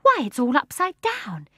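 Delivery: high surprised exclamation; exclaiming. A narrator's reading. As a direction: blurt it out in amazement. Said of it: "exclaimed Jane".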